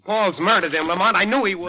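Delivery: breaking down over the body; distraught outburst. Acted. Nephew finds uncle dead.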